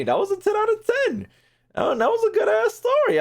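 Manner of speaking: high, excited praise; thrilled. Real, not acted. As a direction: gush about the story you just finished. Thrilled by the story ending.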